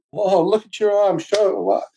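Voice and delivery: amazed retold friends. Friends amazed at his arm.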